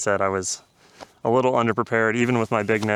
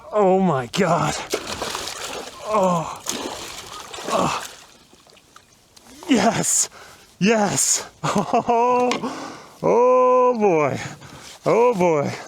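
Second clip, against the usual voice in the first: real joyful yells; 9 semitones higher, much slower than usual. Real, not acted. Pike finally in the net.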